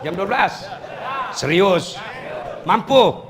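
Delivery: playful astonished questions; mock surprise. Real, not acted. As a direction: tease the crowd in disbelief. Teasing the audience about length.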